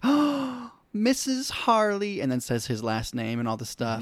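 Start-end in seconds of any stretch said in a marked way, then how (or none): 0.0-2.2 much higher, slow, sugary mock swoon; Harley faking delight at the proposal, high and drawn-out. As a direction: coo it like a delighted bride-to-be. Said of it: "she's like".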